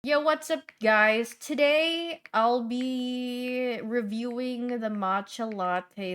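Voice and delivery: high, loud vlogger greeting; youtuber intro. Pretend. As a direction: hype it up for viewers.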